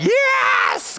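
high cheering yes; excited shout. Retold or imitated. Lazy kids cheering.

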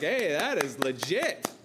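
lightly voiced, slow, impressed onlooker; a hint of an awed, casual angel cheering. Preacher voicing angels watching creation, lightly impressed. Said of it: "They're like".

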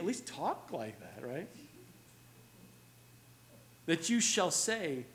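ordinary talk

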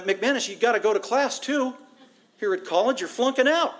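high, pushy mock classmate voice; a lightly hammed-up scolding student; nagging classmate. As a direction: hound him to get to class. Classmates nag him about class.

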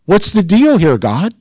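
high-pitched, exasperated complaint to God; acted frustration, pitched well up; challenging God. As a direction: put it to God as a frank challenge. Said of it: "what's he implying?".

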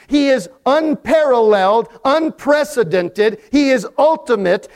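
rhythmic list, each phrase lifting toward a peak; building preaching litany. Acted. Preacher lists what God is to the congregation, building and insistent. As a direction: drive through each attribute without letting up.

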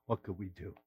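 quiet mourning; hushed grief. Retold or imitated. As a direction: let it fall away in sorrow. Grief after death in story.

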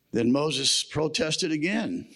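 usual speaking voice

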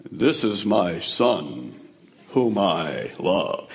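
very low, slow, booming voice of God; vast deep cosmic voice. Pretend. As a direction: speak slowly from the heavens, huge and deep. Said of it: "what do you think God's voice sounded like".

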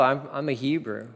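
usual speaking voice

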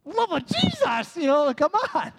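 hammed-up sarcastic whine; mock whine. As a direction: drag out the excuse mockingly. Mocks his own excuse.

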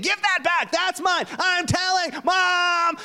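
put-on high, shrieking child's squabble; kids squabbling. Pastor imitating kids yelling downstairs, high mock shriek. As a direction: shout it like a kid fighting over a toy.

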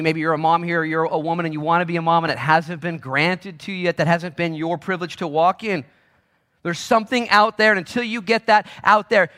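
high, loud, driving sermon; impassioned preaching. Real, not acted. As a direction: press it on the congregation, high and loud. Preaches at full force.

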